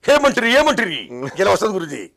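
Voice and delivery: high, loud, booming NTR-style hero dialogue; NTR impression. Asked by the host to show his NTR, a loud booming imitation. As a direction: thunder it out like NTR on screen. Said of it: "NTR లాగా మీరు ఎలా చేశారో చూడాలి".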